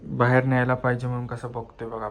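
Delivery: normal talking voice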